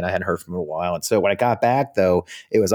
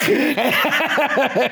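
high burst of real laughter. Real, not acted. After the Cheney punchline, high laugh.

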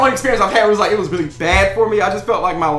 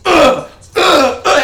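mock angry grunts; 9 semitones higher, 5 dB louder, much slower than usual. Imitating the woman's ferocious grunts.

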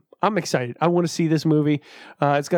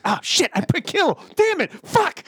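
flustered alien; 12 semitones higher than usual. Pretend. Alien botching written message.

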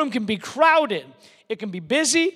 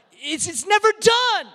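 high, drawn-up wail of complaint; mock exasperation; 9 semitones higher than usual. Preacher acting out endless laundry for the congregation, mock-exasperated. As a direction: throw up a hammed-up protest.

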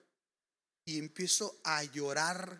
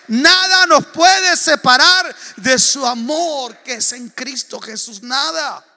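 rising, loud preaching build to a climax; fiery preaching; 6 semitones higher, 15 dB louder than usual. Acted. Preacher to congregation, building to a loud climax. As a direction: preach it louder and louder, line by line.

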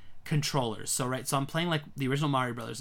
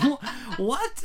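high, incredulous squeak; incredulous what; 10 semitones higher, 4 dB louder than usual. Retold or imitated. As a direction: react in total disbelief. Retold disbelief.

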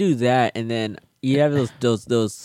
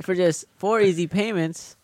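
infomercial; 7 semitones higher, 3 dB quieter than usual. Pretend. Mock infomercial pitch.

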